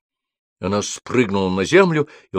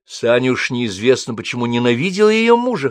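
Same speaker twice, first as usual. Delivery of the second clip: ordinary delivery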